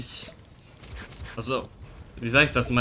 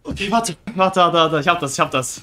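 tense gamer shouting; 5 semitones higher than usual. Real, not acted. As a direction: yell through the fight. Tense fight in game. (angespannt, laut rufend)

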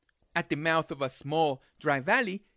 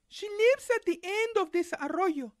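put-on high girl's voice; little girl voice; 12 semitones higher than usual.

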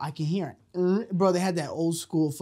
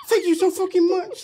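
higher, exaggerated fan gratitude; mock gushing thanks; 11 semitones higher, 3 dB louder than usual. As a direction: gush as an imagined grateful fan. Imagined grateful men responding.

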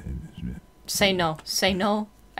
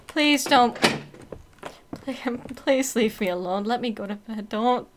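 ordinary delivery

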